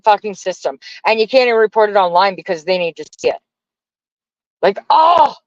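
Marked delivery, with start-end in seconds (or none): 4.6-5.4 high startled cry, much higher; real jolt of noticing the chat; sudden surprise. Real, not acted.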